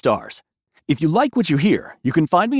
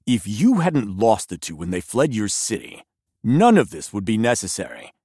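disdainful envoy, low and level; lower, scornful monk envoy; 8 semitones lower than usual. Acted. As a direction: sneer at the headmaster.

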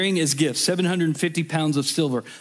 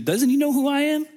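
much higher, put-on voice of an offended proud man; haughty outrage; 10 semitones higher than usual. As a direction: squeak out the insulted pride. He's just filled with pride.

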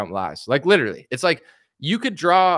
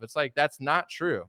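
ordinary talk

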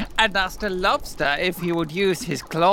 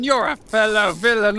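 ordinary talk, nothing marked

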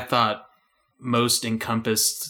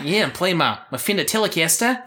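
put-on country accent; 7 semitones higher than usual. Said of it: "a country accent when you sing".

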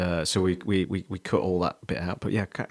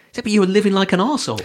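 mocking punchline; 11 semitones higher, 5 dB louder, much faster than usual. Mocking churchgoers.

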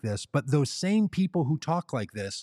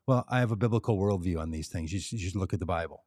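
quick, lower, mumbled mock answer; mock job candidate; 7 semitones lower, 4 dB quieter, faster than usual. Job candidate answering the CEO, a quick, lower mock answer. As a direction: toss it off like a clueless applicant.

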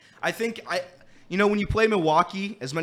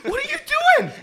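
very high, loud, squeaky disbelief with a laugh; incredulous squeal; 6 dB louder, faster than usual. Retold or imitated. Friends reacting to a terrible gambler, high incredulous squeal. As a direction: squeal it like you can't believe him. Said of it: "And we're like".

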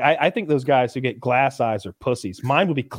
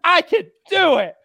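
much higher, louder pleading voice; quoting the contestant; 6 dB louder than usual. Retold or imitated. Contestant pleading with the chef, much higher and louder. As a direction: beg to stay in the kitchen. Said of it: "And she's like".

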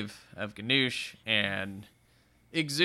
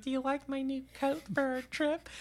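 much higher, soft, sugary mock question; fishing for praise; mock sweet; 11 semitones higher, 8 dB quieter than usual. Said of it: "She's like".

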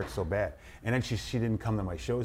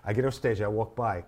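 normal talking voice

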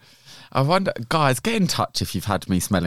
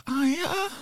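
mock moan; 5 dB quieter than usual. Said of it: "we were like".

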